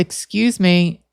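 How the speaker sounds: slow, careful polite call; slow polite excuse me. Retold or imitated. Calling out to stranger in yard.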